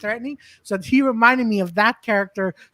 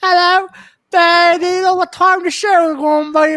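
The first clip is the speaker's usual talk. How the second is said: high, loud overly chipper greeting; mock cheery English bloke; mimicked English comedian; 10 semitones higher, 5 dB louder than usual. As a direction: play him bright and too cheerful. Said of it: "he goes".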